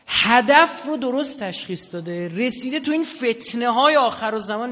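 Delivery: loud, slow, high preacher's climax; rousing preaching. Real, not acted. Preacher praising the martyr to the crowd, loud and slow. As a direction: swell the voice for the climax.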